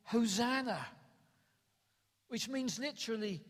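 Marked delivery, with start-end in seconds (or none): 0.0-0.9 one loud, lightly acted cry; retold crowd shout. Preacher retelling the crowd entering Jerusalem, mildly shouted. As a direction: call it out like the crowd, half-shouted. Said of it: "they were shouting".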